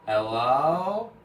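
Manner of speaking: first loud call. Retold or imitated. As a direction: call out into the void. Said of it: "As it echoes".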